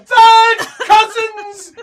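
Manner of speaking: very high, loud, drawn-out shriek; shrill. Acted. Game master voicing a cousin protesting, shrill shriek. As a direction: shriek it indignantly, stretching the words. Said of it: "he says shrilly".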